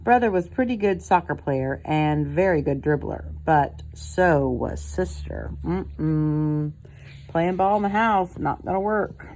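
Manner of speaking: plain reading voice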